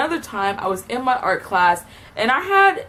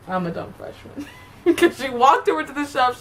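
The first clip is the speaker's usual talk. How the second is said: ordinary delivery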